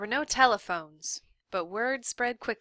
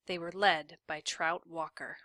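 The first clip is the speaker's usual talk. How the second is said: ordinary delivery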